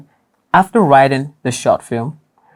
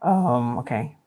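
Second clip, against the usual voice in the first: ordinary delivery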